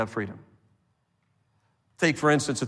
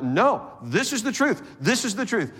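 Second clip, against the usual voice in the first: higher-pitched, insistent repetition; mock dogmatic; 7 semitones higher than usual. Believer arguing and refusing to yield, mildly acted insistence. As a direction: imitate someone who won't give any ground. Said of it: "they're not giving any ground".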